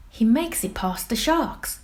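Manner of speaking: plain reading voice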